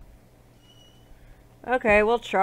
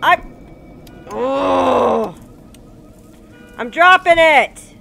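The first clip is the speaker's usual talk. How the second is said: loud high yell; exasperated shout; 5 semitones higher, 12 dB louder, slower than usual. Real, not acted. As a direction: shout that you're dropping it. Yelling back at game character.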